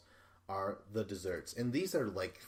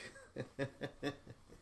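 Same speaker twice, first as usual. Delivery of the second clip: laugh; 5 semitones higher, 6 dB quieter than usual. Real, not acted. Laughing at own ramble.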